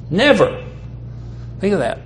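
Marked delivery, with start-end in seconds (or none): 0.1-0.6 Never set alone, pitched higher; stress on Never. Shown as a demonstration. Preacher repeating the verse's first word, stress on Never. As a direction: land firmly on a lone, higher Never. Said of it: "I'm going to emphasize that first word".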